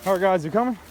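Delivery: calling out. Real, not acted. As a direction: shout back to the guys to keep up.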